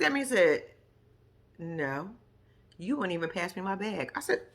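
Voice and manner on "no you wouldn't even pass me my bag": low, hushed retold rebuff; quiet cold refusal. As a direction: imitate an offended woman refusing softly. Retold curt refusal by offended woman.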